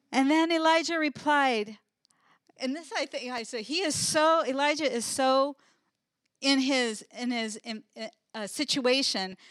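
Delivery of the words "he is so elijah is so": ordinary talk, nothing marked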